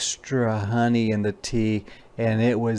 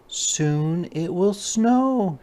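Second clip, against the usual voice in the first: mama bear, higher, very slow; gentle mama bear; 7 semitones higher, much slower than usual. Acted.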